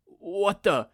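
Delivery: shocked. A narrator's reading. Villain shocked, jaw dropped.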